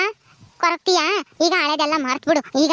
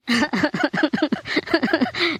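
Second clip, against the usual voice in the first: acted sobbing; 3 semitones lower than usual. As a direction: run of pulsing sobs. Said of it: "ಸುಮ್ನಿರು, ಅಳ್ಬೇಡ". (ಬಿಕ್ಕಿ ಬಿಕ್ಕಿ ಅಳು)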